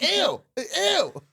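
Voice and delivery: high, hammed-up squeal of disgust; mock disgust. Retelling the moment he just saw her, mock disgusted. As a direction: recoil with a high-pitched yuck.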